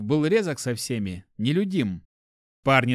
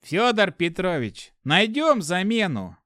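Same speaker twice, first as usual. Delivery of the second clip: cold; 6 semitones higher, 3 dB louder, slower than usual. Acted. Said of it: "холодно ответил Купорос".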